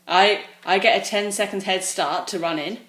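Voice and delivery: ordinary talk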